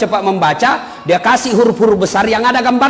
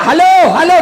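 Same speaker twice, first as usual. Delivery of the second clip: high, loud halo halo with a laugh; mock shouting; 9 semitones higher, 5 dB louder than usual. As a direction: yell it into the wrong end of the phone. (teriakan pura-pura sambil tertawa)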